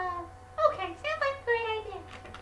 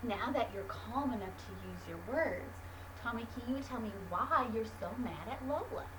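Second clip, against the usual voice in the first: much lower, soft soothing coaching; calm teacher voice; 13 semitones lower, 13 dB quieter than usual. Acted. Teacher calms angry Tommy with a deep breath, soft soothing voice.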